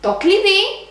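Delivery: Το κλειδί with the stress on the final syllable, κλει-ΔΙ; accent on last syllable. Shown as a demonstration. Teacher showing learners where κλειδί takes its accent, stress on the last syllable. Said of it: "has the accent at the last syllable".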